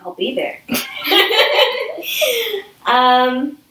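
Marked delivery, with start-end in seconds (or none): 0.6-2.6 high-pitched burst of laughter; real, high laugh. Real, not acted. Host laughs after own quip.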